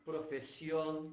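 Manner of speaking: "Profesión" slow, stress on the final syllable; modelling a Spanish word. Shown as a demonstration. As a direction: stretch out "Profesión", leaning on the last syllable. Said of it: "também vai levar acento".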